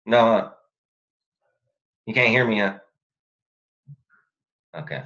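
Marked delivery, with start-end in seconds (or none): none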